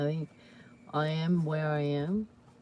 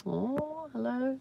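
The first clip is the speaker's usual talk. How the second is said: high, drawn-out cooing hello; sweet greeting; 8 semitones higher, slower than usual. Real, not acted. Streamer answering a passed-on hello, high coo. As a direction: coo a hello back, pitched up.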